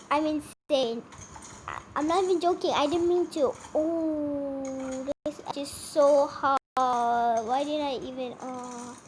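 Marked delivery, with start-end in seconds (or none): none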